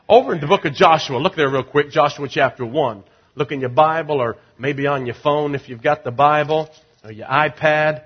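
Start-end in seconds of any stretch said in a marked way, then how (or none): none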